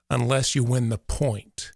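ordinary delivery

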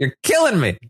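high-pitched mock despair; comic exasperation. Host despairs at Paloma's logic, exasperated.

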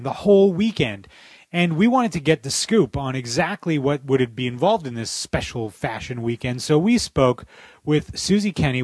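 upbeat, projected broadcast read; bright on-air intro over music; radio host intro. Acted. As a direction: sell the segment like a radio host.

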